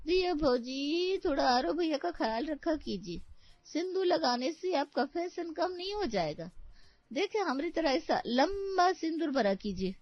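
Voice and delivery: high, singsong village-woman voice; meddling rustic laundry woman. Acted. Laundry woman nags bride about long sindoor, meddling rustic voice.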